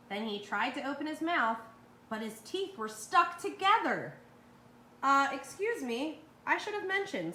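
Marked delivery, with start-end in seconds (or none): 5.0-7.3 mock-polite dentist. A narrator's reading. Dentist coyly tells the trapped fox, mock apologetic. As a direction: feign polite surprise. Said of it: "said Doctor DeSoto".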